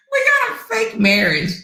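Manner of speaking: high squeaky mock disbelief. Real, not acted. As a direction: echo the rumor in a high, scoffing voice. Mocking the rumor.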